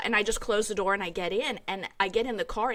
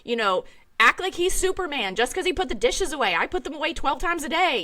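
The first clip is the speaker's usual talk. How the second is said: high, loud, resentful complaint; huffy, sarcastic grumble; mock indignant sister; 6 semitones higher, 5 dB louder than usual. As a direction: sister complaining about thanking her husband. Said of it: "My sister has said".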